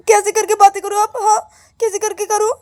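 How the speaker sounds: high, loud questioning comeback; exasperated retort. Acted. Scolded girl asking how else to talk, exasperated retort.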